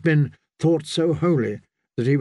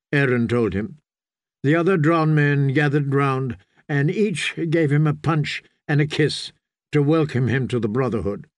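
ordinary delivery, nothing marked

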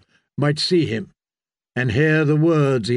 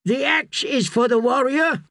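pitched-up narrated shout; shouting back; 10 semitones higher than usual. A narrator's reading. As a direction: call the answer back from the crowd. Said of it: "Russ shouted back".

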